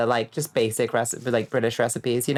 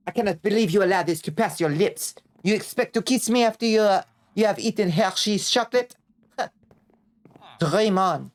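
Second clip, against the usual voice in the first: put-on French accent; 5 semitones higher than usual. French snob mocking Hershey's.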